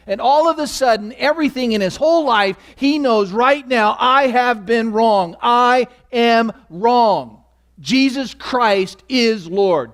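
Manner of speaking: high, loud, slowing preacher's climax; preaching at full force. Real, not acted. Preacher telling his church of Paul's conversion, slow and loud. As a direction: build it up until every word rings.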